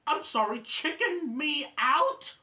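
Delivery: loud, high offended query; Bambi. Retold or imitated. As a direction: challenge it, rising high and stretching the end. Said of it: "And then Bambi would say".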